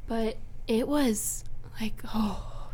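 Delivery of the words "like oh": whispered awe. Real, not acted. Describing beautiful ceremony.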